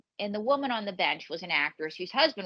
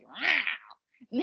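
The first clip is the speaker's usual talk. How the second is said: lion growl; 3 semitones higher than usual. Pretend. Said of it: "rip you up like a lion".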